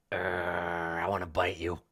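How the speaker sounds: low growling dog voice; dog's growl. Acted. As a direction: growl the words low in the throat. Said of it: "the growl is like".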